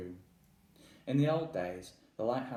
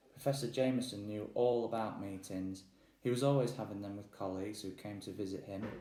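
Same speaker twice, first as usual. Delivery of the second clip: ordinary delivery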